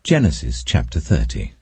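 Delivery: ordinary delivery, nothing marked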